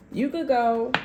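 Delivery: lightly voiced cold brush-off; mock dismissive partner. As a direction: toss it off like you don't care.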